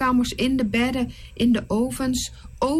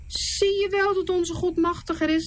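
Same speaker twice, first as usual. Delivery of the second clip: higher, lightly voiced glee; triumphant Moses; 9 semitones higher than usual. Moses thinking after the snake eats the others, mildly triumphant.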